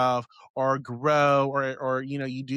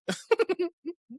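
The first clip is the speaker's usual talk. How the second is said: high giggle. Real, not acted. Laughing at own impression.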